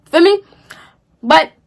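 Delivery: usual speaking voice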